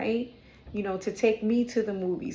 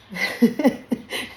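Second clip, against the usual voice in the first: loud, higher burst of laughter; real laugh; 4 semitones higher, 5 dB louder than usual. Real, not acted. Reader catching herself going off topic, real laugh. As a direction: laugh at yourself mid-story. Laughs at her own digression.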